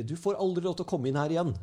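a hammed-up screaming threat; retold shout. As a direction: play the pursuers yelling after you. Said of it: "så skriker de".

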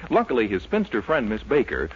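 usual speaking voice

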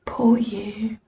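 mock sympathy. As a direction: imitate hushed, embarrassed pity. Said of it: "with that kind of sympathetic smile thing".

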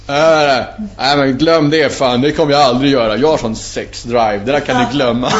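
loud, cocky brush-off, pleased with himself; smug refusal. Retold or imitated. Brushing off the tantra advice, loud and smug. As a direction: swat the idea away, dead sure of himself. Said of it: "Typ så här, skitnöjd med mig själv".

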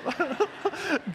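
high, soft chuckle; real amused laugh. Real, not acted. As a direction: laugh at your own aside. Laughs at own salt joke.

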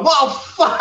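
high, laughing "well, fuck"; laughing mock dismay. Reader caught by the next chapter, laughing in dismay. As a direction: laugh it out in fake despair. Retold reaction after reading on.

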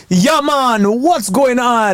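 hammed-up fake Jamaican accent; bad cartoon Jamaican voice. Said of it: "a really horrible accent".

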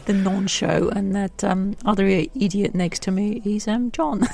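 ordinary talk, nothing marked

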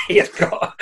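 breathy laugh on the word. Real, not acted. Echoing a friend's haircut joke, laughing. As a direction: repeat it while cracking up.

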